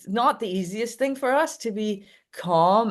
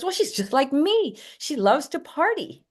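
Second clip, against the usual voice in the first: proud owner; 8 semitones higher than usual. Retold or imitated. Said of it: "And he says".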